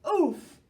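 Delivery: high loud oof; effort grunt. Acted. Monkey toppling over, a loud oof.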